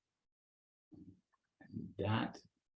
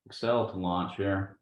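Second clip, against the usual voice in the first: west texas twang; 3 semitones higher, 6 dB louder than usual. Real, not acted. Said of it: "Went into my West Texas voice".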